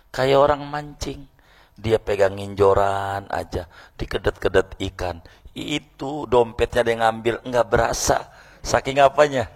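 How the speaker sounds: ordinary talk, nothing marked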